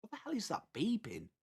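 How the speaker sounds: very soft, half to himself; puzzled aside. Real, not acted. Streamer hearing a strange beep, soft puzzled aside. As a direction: wonder aloud about a noise.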